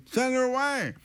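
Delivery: insistent demand. Retold or imitated. Disciples' demand to Jesus, acted out.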